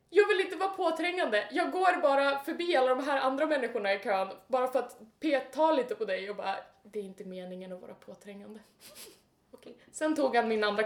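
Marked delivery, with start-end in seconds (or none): 0.1-6.6 raised, exasperated mock excuse; loud mocking replay. Ridiculing the drunk man who came up in the queue, loud mockery. 6.8-8.6 soft, low, hurried apology; quiet mock excuse. Drunk man poking her in the queue, quiet sheepish excuse.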